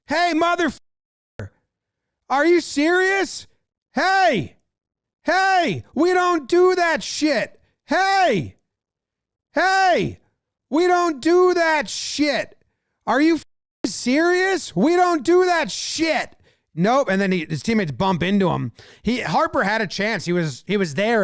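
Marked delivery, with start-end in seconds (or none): 0.1-10.2 imitating yelling. Harper yelling at the pitcher from behind: high mock yell. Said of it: "yelling at him". 10.7-12.6 imitating yelling. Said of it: "yelling at him". 13.1-16.4 imitating yelling. Harper yelling at the pitcher from behind: high mock yell. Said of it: "yelling at him".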